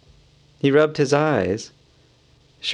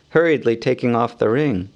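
ordinary delivery, nothing marked

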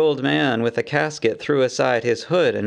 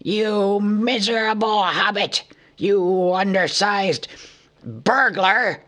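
higher, slow furious insult; angry shout; 7 semitones higher, slower than usual. A narrator's reading. Thorin shakes Bilbo, shouting at a loss for words. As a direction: spit the insult out. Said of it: "he shouted at a loss for words".

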